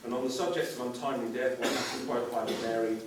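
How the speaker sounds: mock-serious documentary voiceover; solemn documentary narration. Mock documentary narration, lower and softer.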